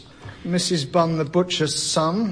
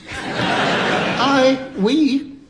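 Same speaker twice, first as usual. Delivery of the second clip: staccato; 5 semitones higher than usual. Pretend. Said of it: "panellists alternating one word at a time".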